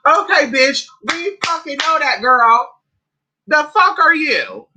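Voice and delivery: high-pitched, exasperated clapback; indignant retort. Real, not acted. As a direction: fire back indignantly, pitch jumping up. Angry retort to quoted statement.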